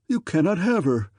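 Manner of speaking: king crying out in jealous anger; possessive cry. Acted. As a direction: refuse him outright. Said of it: "cried Lord of Armon".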